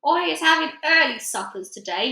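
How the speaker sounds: loud gleeful giant boast; shouted. A narrator's reading. Giant gloating over a soldier, loud and gleeful. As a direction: crow it over your catch. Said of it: "he shouted".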